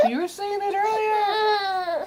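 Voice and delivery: very high slow sing-song; sing-song baby talk. Pretend. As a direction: croon it to the baby.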